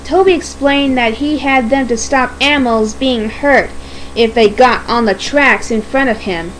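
ordinary delivery, nothing marked

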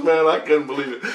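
usual speaking voice

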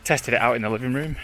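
usual speaking voice

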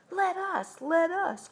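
put-on high-pitched begging kids; eager kids' cry. A narrator's reading. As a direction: plead eagerly like two excited children. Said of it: "cried Joe and Spud".

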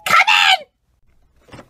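loud yell to the door; calling out. Acted. Becky calling to a friend at the door, loud holler. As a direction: holler it across the house.

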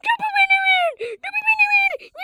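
high-pitched shrieking demand; shrieking cry. Real, not acted. Yelling for the ball, crying.

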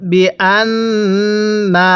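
very slow, drawn-out syllables; model recitation. Shown as a demonstration. Teacher models Quran words for learners, slow measured recitation. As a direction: stretch each long vowel evenly. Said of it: "তাহলে পড়ুন".

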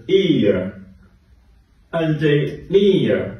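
"ear" drawn out very slowly, with "N J" spelled in between; slow classroom demo of "ear"; slow model sound. Shown as a demonstration. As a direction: say "ear" slowly and clearly for learners to copy. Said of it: "发的是ear".

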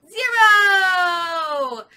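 loud, high cheer; excited shout. Acted. Counting monkeys with the children, excited loud cheer. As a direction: burst out the answer with glee.

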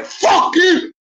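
furious shout at a heckler; screaming. Real, not acted. Angry man screaming at a stranger on a live chat. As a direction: yell it at him with full force. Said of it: "Screaming, lad".

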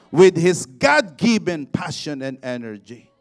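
loud, slow, declaiming pulpit voice; full-force preaching. Acted. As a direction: thunder each promise to the congregation.

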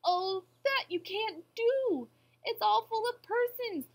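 loud, high, drawn-out plea; alarmed protest. A narrator's reading. Horton defending the tiny persons, alarmed protest. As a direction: protest with full voice, stretching words. Horton pleads for the speck's people.

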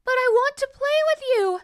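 put-on little girl's high, loud plea; crying out. A narrator's reading. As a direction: call out pleadingly as a child. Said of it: "the little girl cried".

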